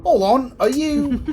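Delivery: postman Creed, startled. Acted. Creed suddenly suspecting the player likes him, high and startled. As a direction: pipe up in sudden hopeful surprise.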